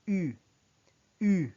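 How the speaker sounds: U with rounded lips and an E sound inside; French U. Shown as a demonstration. Teacher showing the French vowel, rounded pronunciation. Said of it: "making the U sounds like".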